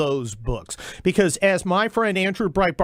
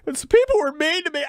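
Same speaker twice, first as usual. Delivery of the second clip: hammed-up fake sob, higher and fast; mock crying; 9 semitones higher, faster than usual. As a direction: pretend to break down in tears, mocking the congressmen. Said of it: "I'm getting emotional here".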